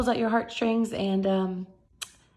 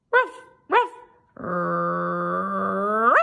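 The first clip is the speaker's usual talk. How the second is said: dog barks; 3 semitones lower, 3 dB louder, much slower than usual. Acted. Arfie punning on his rough days with barks. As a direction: bark the word like a dog.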